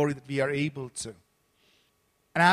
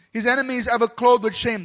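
solemn scripture reading; 5 semitones higher, 7 dB louder than usual. Acted. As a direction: proclaim each verse a little louder, like a preacher reading Psalm. Reads scripture aloud.

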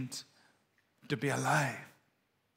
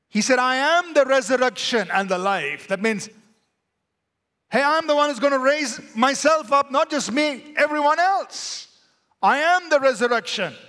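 loud, higher, driving sermon voice; full-force preaching; 8 semitones higher, 5 dB louder than usual. Acted. Preacher proclaiming the resurrection to the congregation, fervent. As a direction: preach it with fire.

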